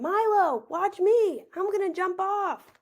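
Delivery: high, loud call from the top of the slide; big brother showing off from the top of the slide; shouting down. A narrator's reading. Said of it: "when he shouted down".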